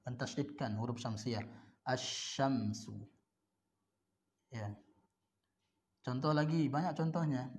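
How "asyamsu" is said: Asyamsu slow, doubled sy; lam not read. Shown as a demonstration. Teacher models Asyamsu for students, slow. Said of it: "Cara membacanya seperti tadi".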